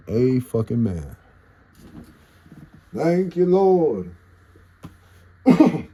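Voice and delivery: loud high relieved praise; loud thanks. Real, not acted. Streamer thanking the Lord his phone survived, loud high praise.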